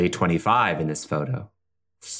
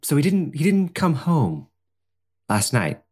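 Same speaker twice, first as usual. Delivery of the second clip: higher, nervous and hesitant; flustered young woman; 11 semitones higher than usual. Acted. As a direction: stammer like a confused guest. Plays worried Madeline.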